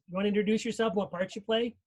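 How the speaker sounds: ordinary talk, nothing marked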